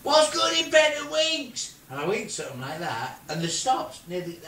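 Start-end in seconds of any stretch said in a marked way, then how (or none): 0.0-1.8 high, slow, drawn-out yell; shouting. Retold or imitated. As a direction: shout a joke across to someone flying past. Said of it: "So I shout".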